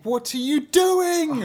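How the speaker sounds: high, drawn-out exasperated cry; mock dismay. Listener scolding Rapunzel for her blunder, exasperated high cry. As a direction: wail it slowly in exasperation.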